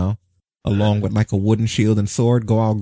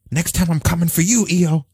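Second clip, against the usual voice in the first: higher hammy villain threat, shouted; sputtering foiled villain; 6 semitones higher than usual. Pretend. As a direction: yell a cartoon villain's vow.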